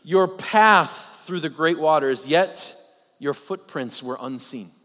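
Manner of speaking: slow solemn scripture reading. Acted. Preacher reading a psalm to his congregation, slow and solemn. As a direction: read the psalm slowly, with reverence. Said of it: "that says about God".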